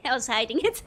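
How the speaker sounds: "I was hiding it" blurted out loud; loud laughing admission; loud confession. Real, not acted.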